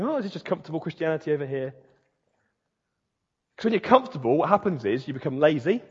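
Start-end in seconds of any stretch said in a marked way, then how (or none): 0.0-1.7 quick, softer, cosy self-satisfied murmur; mock complacency. Preacher imitates believers retreating into comfort, mock complacency. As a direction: toss it off as if settled in comfort. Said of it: "living in the retreat of going".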